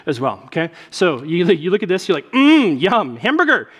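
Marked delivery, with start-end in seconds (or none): 2.3-3.7 hammed-up mm-yum, pitched up; delighted. Pastor playing you eyeing a hamburger, delighted. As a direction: relish it like tasty food, higher and louder.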